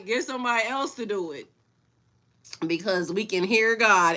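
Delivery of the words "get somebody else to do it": much softer, settled repeat; soft aside repeat; quiet repeat. Real, not acted. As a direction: say it again under the breath.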